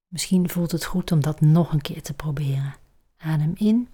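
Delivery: breathy guided meditation voice; soft spa whisper. Acted. Leads a relaxation exercise.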